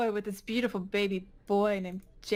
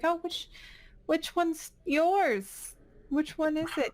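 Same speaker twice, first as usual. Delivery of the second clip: high, hesitant questioning; flustered nurse; 7 semitones higher than usual. Acted. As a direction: ask helpfully in a much higher voice. Nurse gives way, asks Jane.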